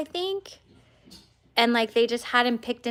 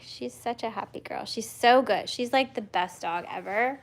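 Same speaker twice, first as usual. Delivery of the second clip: ordinary talk, nothing marked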